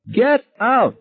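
high piercing scream of get out. A narrator's reading. Wife driving her husband out, high scream. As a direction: throw him out with a shrill scream. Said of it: "she screamed".

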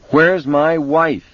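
high, slow anguished shout. A narrator's reading. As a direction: shout it desperately into the phone. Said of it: "Vic shouted into the phone".

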